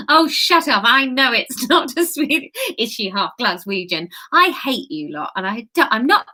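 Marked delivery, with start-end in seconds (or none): none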